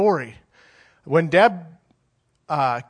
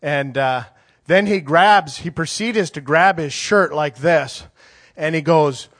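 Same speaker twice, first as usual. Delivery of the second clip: ordinary talk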